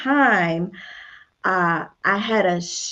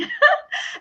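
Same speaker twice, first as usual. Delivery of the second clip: high laugh. Real, not acted. Laughs at the workload.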